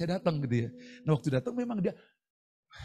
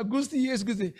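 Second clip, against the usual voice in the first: meniru tangisan; 10 semitones higher than usual. Retold or imitated.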